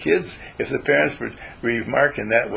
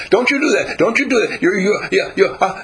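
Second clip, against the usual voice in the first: put-on high, louder child's scold; little girl scolding; 7 semitones higher, 4 dB louder than usual. Little Ellen scolding Rex, high and loud.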